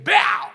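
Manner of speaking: loud, high "bow!" punch effect; punch sound. Retold or imitated. Preacher acts out Paul getting knocked down with a loud punch sound. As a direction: smack the word out like a knockout blow.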